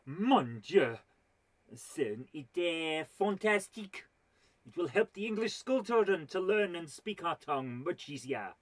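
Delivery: over-the-top French exclamation; mock French academician thrilled by an idea, bad accent; terrible French accent. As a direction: ham it up as an excited Frenchman. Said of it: "this is a terrible accent".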